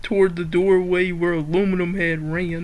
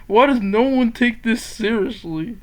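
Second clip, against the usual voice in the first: ordinary talk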